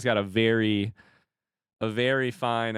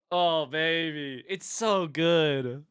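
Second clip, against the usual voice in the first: doting coo; 4 semitones higher, much slower than usual. Real, not acted. Streamer cooing over the card art.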